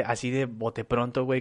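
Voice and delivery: ordinary talk, nothing marked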